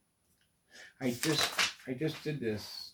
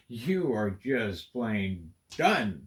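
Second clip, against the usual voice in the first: slow mock verdict; 2 semitones lower, 4 dB louder, much slower than usual. Real, not acted. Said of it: "self-mocking verdict".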